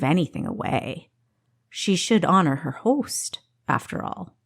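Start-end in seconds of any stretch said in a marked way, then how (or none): none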